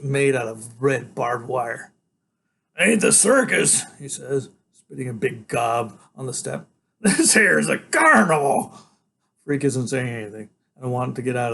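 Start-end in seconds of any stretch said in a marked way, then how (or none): 2.8-3.8 tough biker voice. A narrator's reading. Hairy biker speaks. 7.0-8.8 gruff biker, loud and amused; tough biker voice. A narrator's reading. Biker after laugh.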